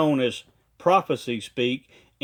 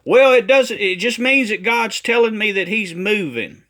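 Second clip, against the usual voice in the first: mock protesting prophet, higher and louder; prophet's excuse; 6 semitones higher, 5 dB louder than usual. Critic mimics a prophet explaining himself away, mock defensive. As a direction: imitate a defensive excuse, pitched up. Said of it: "what he tries to say is".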